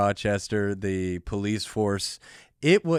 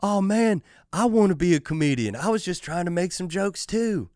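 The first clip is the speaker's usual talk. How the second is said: put-on higher, pleading drunk who wants to be a comedian; whiny drunk wannabe; 8 semitones higher than usual. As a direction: imitate a sulky drunk excusing himself. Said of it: "this guy was like".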